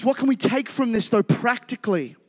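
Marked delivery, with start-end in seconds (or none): none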